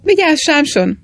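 alarmed shout to a friend about the tulip; shouted warning. A narrator's reading. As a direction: call out a sudden warning across the garden. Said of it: "kiáltott Flambő".

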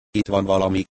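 usual speaking voice

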